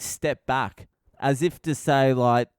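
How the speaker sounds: normal talking voice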